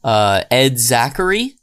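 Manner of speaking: ordinary delivery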